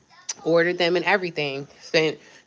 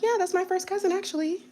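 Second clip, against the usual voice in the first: high, breezy lie; smug fake boast; 14 semitones higher, 5 dB quieter than usual. Lying to the recruiter about a famous cousin, high and breezy. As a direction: say it airily like it's nothing. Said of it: "I'm just like".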